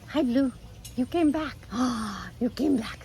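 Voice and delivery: high, soft cooing at the parrot; daughter's baby voice to bird. Retold or imitated. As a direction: coo like greeting a lost baby bird. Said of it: "She goes".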